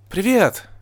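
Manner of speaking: high, bright-spirited excited greeting; exclaiming. A narrator's reading. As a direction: greet eagerly with a high cry. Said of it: "воскликнула Эпплблум".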